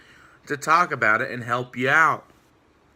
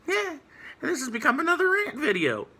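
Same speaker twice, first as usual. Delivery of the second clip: mocking critics; 7 semitones higher than usual. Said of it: "people are sitting here like".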